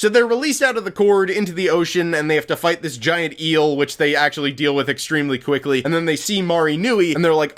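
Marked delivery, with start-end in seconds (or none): none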